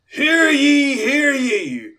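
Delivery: booming town-crier proclamation, high and loud; magically amplified voice. Acted. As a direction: bellow it like a herald, slow. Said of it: "cast Thaumaturgy to make my voice louder".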